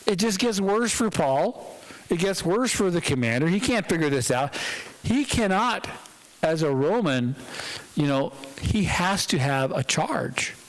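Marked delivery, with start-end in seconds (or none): none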